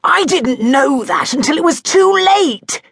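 defensive protest. A narrator's reading. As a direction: bluster your way through the excuse. Duke defends himself.

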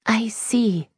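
high, strained little acknowledgement; flat and strange. A narrator's reading. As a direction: answer oddly, without enthusiasm. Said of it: "her voice coming out flat and strange".

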